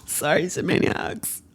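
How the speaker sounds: soft, tearful apology; choked up and quiet; emotional. Acted. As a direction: apologise through tears, barely voiced. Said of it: "I'm so emotional".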